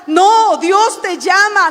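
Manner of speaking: forceful sermon cry; emphatic preaching. Acted. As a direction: plead with the church, hard. Emphatic pulpit call.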